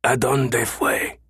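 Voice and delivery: slow, strained, misshapen voice; strange choked voice. A narrator's reading. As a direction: choke the words out slowly. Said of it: "the voice repeated".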